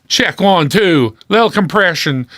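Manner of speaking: booming radio voice check; radio announcer mic check. Shown as a demonstration. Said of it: "You know, radio guy".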